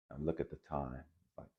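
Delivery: ordinary delivery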